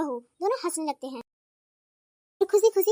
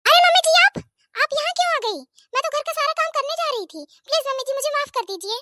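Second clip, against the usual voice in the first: much higher, flustered and pleading; startled daughter-in-law; 10 semitones higher, 3 dB louder, faster than usual. Acted. Daughter-in-law caught sleeping begs mother-in-law, flustered. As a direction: blurt out a panicked apology.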